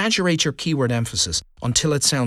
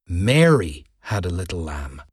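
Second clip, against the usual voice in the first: "Mary" stretched out and leaned on hard, the rest falling away; emphasize the word "Mary", sounding it out; stress on Mary; 7 semitones lower than usual. Shown as a demonstration. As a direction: punch "Mary", then a short pause. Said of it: "emphasizing different words".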